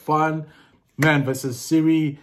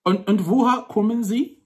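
higher-pitched attempt at a German accent; 8 semitones higher, 3 dB louder than usual. Shown as a demonstration. Man testing Siri in German, with a put-on German accent. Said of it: "let me try German".

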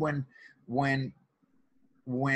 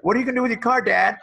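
put-on higher, louder eager teen voice; son's eager question; 10 semitones higher, 7 dB louder, faster than usual. Teen son asking dad about the car, eager and high. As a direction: bounce it up like a thrilled kid. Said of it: "He's like".

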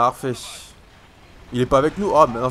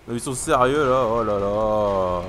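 normal talking voice

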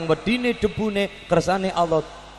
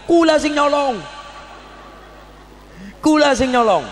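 eager shouted confession; 8 semitones higher than usual. Acted. Greedy disciples confess for gold.

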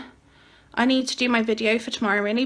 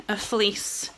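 usual speaking voice

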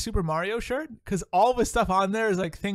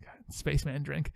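breathy, toneless mock whisper; 3 semitones lower, 5 dB quieter than usual. Real, not acted. Co-host mocks Tang as a spaceman drink, whispered. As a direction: hush it like a private joke.

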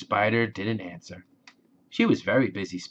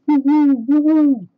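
very high, loud hoots; owl hooting; 12 dB louder than usual. A narrator's reading. Owl asking about the spider's web, hooting. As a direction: hoot like an owl, high and ringing. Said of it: "asked the owl".

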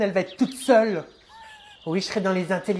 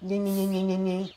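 monotone nyah-nyah mimicry; childish mocking gibberish; mocking taunt. Child mocking siblings' threats, mocking taunt.

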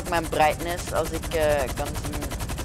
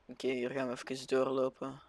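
ordinary delivery

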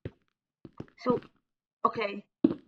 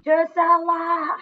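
loud, high, long drawn-out name call; 9 semitones higher, 7 dB louder, much slower than usual. Real, not acted. Host summoning a friend up to the panel, calling out. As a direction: holler the name high and slow.

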